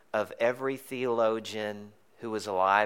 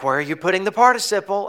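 higher, quick mock teacher; teacher's question; 8 semitones higher, 7 dB louder, faster than usual. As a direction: quiz the student like a fussy teacher. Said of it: "They were like".

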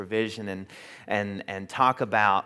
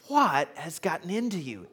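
scolding; 7 semitones higher than usual. Retold or imitated. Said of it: "slaps him really hard on the arm and goes".

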